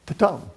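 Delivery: "ta-tum" पहले हल्का ta फिर ज़ोरदार tum; iamb beat. Shown as a demonstration. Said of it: "like this".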